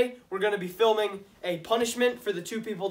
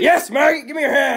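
shouted higher and louder; barking drill sergeant; 6 semitones higher, 12 dB louder than usual. Acted. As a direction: bark the order at recruits. Sergeant ordering recruits to eat.

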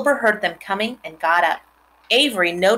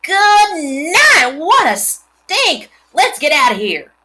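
loud, much higher yell, stink slowed; a boy screaming at the rotten egg stink; screaming in disgust; 10 semitones higher, 8 dB louder, slower than usual. A narrator's reading. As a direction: cry out and flee the smell. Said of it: "screamed Avery".

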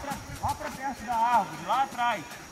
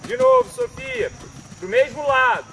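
loud, higher call across the arena; calling from far away; 12 semitones higher, 12 dB louder than usual. Real, not acted. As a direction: call out to the distant rider.

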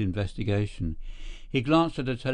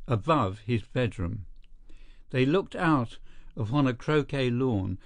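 ordinary delivery

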